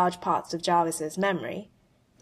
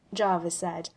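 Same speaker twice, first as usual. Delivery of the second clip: ordinary delivery, nothing marked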